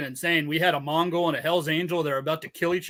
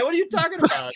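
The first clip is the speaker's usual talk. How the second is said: high incredulous protest; 11 semitones higher than usual. Retold or imitated. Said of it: "I was like".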